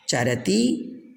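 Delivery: चरति stretched out very slowly, syllable by syllable; slow model word. Shown as a demonstration. Teacher stretches चरति slowly for the class. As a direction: draw चरति out slowly for students to copy. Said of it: "चरति एक वचन".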